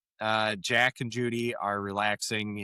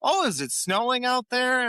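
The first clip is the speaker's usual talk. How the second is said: higher, overeager fussing; eager host; 12 semitones higher than usual. Retold or imitated. Chandler fussing over Monica's parents, high eager. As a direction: fuss over them to win favor.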